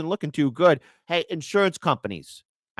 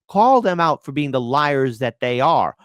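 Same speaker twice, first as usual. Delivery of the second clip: ordinary talk, nothing marked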